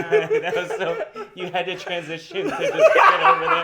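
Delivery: high, loud laughing tease. Real, not acted. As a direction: tease while cracking up. Teasing through laughter.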